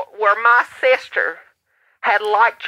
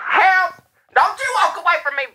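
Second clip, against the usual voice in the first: heated family shouting; 8 semitones higher, faster than usual. Acted. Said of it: "I got a family emergency here".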